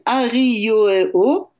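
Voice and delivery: Arioeo said slowly in a Polynesian accent. Shown as a demonstration. Radio guest apologizes, then says the bride's name in a Polynesian accent. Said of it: "je m'excuse pour, euh, mon accent polynésien".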